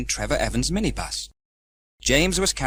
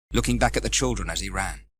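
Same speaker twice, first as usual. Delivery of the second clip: ordinary delivery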